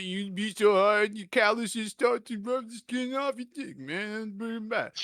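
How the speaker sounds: lightly voiced punch-drunk Rocky. Rocky lecturing the young boxer, dopey slow drawl. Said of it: "Rocky's like".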